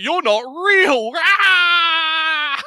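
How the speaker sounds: high, slow, laughing and shrieking; a madman cackling at a hallucination; laughing mad guy. Retold or imitated. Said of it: "he's like".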